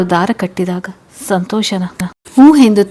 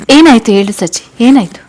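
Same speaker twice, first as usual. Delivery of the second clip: louder, higher, pressing for an answer; insistent, raised-voice question; urgent questioning; 3 semitones higher, 8 dB louder than usual. Acted. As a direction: demand to know what happened. Worried uncle demands what happened.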